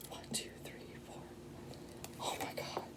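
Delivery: very faint whispered count; whispering to herself. Real, not acted. Reader counts cards to herself, whispering. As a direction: count under your breath, then gasp softly in awe.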